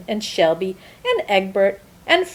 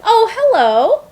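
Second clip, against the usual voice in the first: bright hello; 12 semitones higher, 7 dB louder than usual. Acted. Good egg greets listener brightly.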